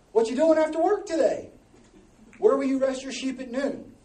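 high, loud mock quote; hammed-up quote. Preacher mimicking a lover asking after work, hammed-up and loud. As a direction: call it out big and high, hamming it up. Acting out a verse, mock wooing.

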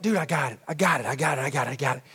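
rapid low repeated assurance; acted quick dismissive confidence; brushing off. David brushing off Saul, repeating.

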